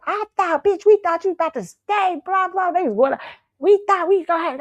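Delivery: relieved friends. Retold or imitated. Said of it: "my friend said".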